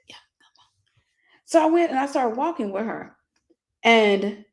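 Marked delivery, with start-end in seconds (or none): 0.1-0.7 soft unvoiced whisper; whispered invitation. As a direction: beckon in a hushed whisper. Older woman beckoning from afar.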